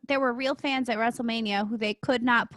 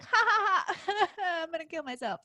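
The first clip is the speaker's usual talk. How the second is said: manic laughing villain voice; Joker laugh; 5 semitones higher, 6 dB quieter than usual. Retold or imitated. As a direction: cackle it like the Joker. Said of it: "you know how Joker is like".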